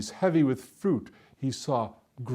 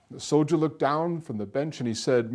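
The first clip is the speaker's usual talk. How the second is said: ordinary delivery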